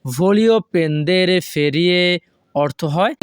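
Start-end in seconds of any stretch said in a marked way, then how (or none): 0.1-2.0 very slow, careful Italian model reading; teacher pacing it out; slow model sentence. Shown as a demonstration. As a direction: draw each word out for learners. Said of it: "এই শব্দগুলো যদি আমরা একসাথে বলি".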